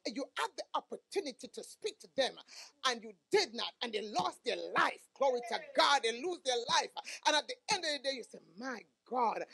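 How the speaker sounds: sermon rising toward a climax; building preaching. Real, not acted. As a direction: build the exhortation phrase by phrase.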